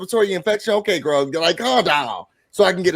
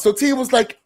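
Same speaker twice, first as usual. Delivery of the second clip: ordinary talk, nothing marked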